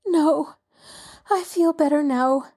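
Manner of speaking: ordinary delivery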